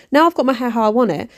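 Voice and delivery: normal talking voice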